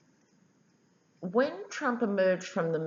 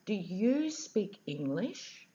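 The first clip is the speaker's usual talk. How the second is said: very slow, over-enunciated question, pitched up; imitating someone talking down, word by drawn-out word; loud and slow; 5 semitones higher, slower than usual. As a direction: spell it out slowly as if to a foreigner. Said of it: "she said very loudly and slowly".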